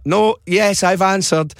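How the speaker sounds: mock impatience, insisting to the caller; exasperated dispatcher. Taxi man tells old caller he has answered, exasperated. Retold dispatcher losing patience.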